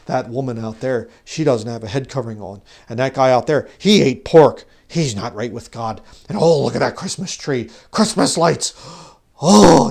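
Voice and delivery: loud, scandalized mock legalist, rising in outrage; judgmental legalist. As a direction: mimic a shocked, finger-pointing legalist. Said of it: "start to get very legalistic".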